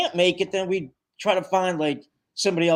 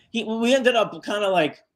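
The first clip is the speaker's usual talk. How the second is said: normal talking voice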